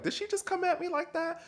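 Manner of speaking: squeaky falsetto of shocked disbelief; mock-offended high squeal; gagged disbelief. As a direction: mimic Andy stunned, voice shooting way up. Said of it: "Andy looked like he was kinda gagged".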